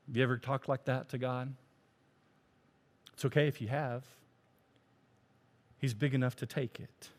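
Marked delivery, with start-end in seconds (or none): none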